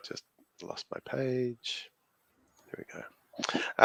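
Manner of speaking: slow whispered aside to herself; muttered whisper after losing her page. Real, not acted. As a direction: breathe it to yourself while finding your place. Finding the lost page.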